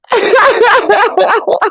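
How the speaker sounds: high loud laughing; chipmunk-high laughter; squeaky laugh. Pretend. Squeaky voice game.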